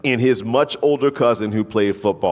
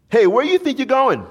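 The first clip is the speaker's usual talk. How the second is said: put-on much higher, louder taunting teen; mock bully challenge; 4 dB louder than usual. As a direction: block his path with a sneering high taunt. Teenage bully stops the boy.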